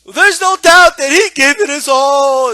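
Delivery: loud, high, drawn-out fake weeping; mock crying. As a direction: imitate a commentator bawling through his praise. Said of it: "I think he fucking cried".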